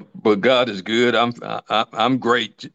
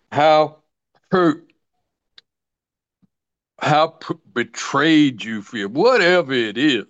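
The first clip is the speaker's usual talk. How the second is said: building list, pitch climbing higher; rising preaching; 4 semitones higher than usual. Acted. Preacher rallying the congregation, building up.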